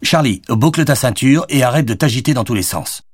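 mildly stern father's order; giving orders. A narrator's reading. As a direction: order a fidgety child to settle down. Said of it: "ordonna Jonathan".

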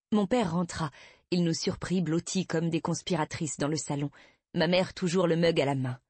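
plain reading voice

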